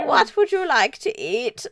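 a hint of posh royal host, slow; mild mock queen offering food; lightly voiced queen. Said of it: "That's all my dad can do".

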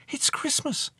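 put-on high, light girlish voice; young girl replying. A narrator's reading. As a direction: answer him simply in a young girl's high voice. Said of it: "Elspeth replied".

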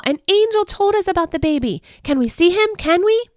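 put-on high, eager child voices pleading; bouncing kids begging to see the baby; eager children. Shepherd children begging the woman, high and eager.

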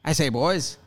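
mock mid-Atlantic gent; hammed-up mid-Atlantic accent. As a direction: put on a mid-Atlantic accent, hailing the lads. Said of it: "Mid-Atlantic accent".

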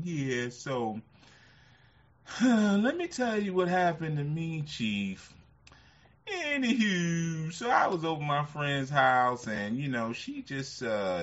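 high, loud put-on voice with a sigh; imitating a caller. Old radio caller retelling his day, in a high put-on voice. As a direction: play the rambling caller telling his story. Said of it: "He used to talk like Wendell from Key and Peele".

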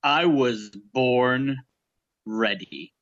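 slow, low swagger line; Jack Burton impression. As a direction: drawl it like a cocky hero, low and slow. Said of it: "joined by Jack Burton himself".

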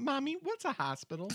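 put-on high little kid voice; child voice. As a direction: ask it like a small child. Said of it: "that's when I wrote down".

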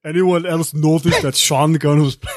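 put-on slow, thick Austrian accent; Arnold Schwarzenegger. Cohost performing on friends' request, Austrian accent impression. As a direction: attempt a heavy Austrian action-hero accent, slowly. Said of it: "[name], do the Schwarzenegger".

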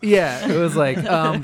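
ordinary talk

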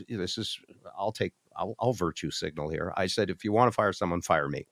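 ordinary talk

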